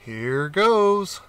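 a touch of a nervous cat; cat setting off. Acted. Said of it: "said the cat".

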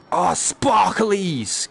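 high, slow, drawn-out delight; excited coo. Real, not acted. Delighted by finding sparklers.

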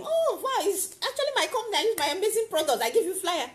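bright, high, fast pitch to a stranger; excited sales pitch. Retold or imitated. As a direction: sell it with eager delight. Pitching own products to a stranger.

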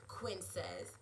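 ordinary delivery, nothing marked

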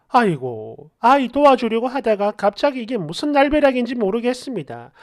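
ordinary delivery

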